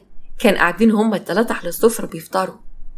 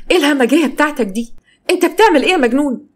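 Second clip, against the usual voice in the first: high-pitched shocked protest; outraged; 6 semitones higher, 3 dB louder than usual. Acted. Wife after husband threw tea at her, outraged. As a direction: lash out in disbelief, pitch well up.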